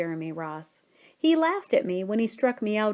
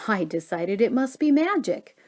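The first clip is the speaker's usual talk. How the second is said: plain reading voice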